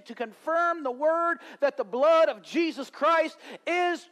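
high, loud, driving sermon voice; full-force preaching. Acted. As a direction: preach it, building up the fire.

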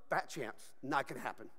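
low, quiet put-down; dismissive. Real, not acted. Preacher ruling out victory on your own, dismissively. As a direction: toss it off low and soft. Dismisses winning over sin alone.

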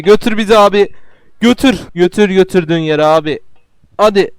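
loud, high urging; urgent pleading. Real, not acted. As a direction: beg the platform to move. Urging game platform loudly.

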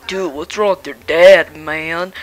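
usual speaking voice

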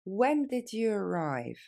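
when did you arrive said slowly; slow and clear for the learner. Shown as a demonstration. Teacher modelling for students, slow. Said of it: "Plus lentement".

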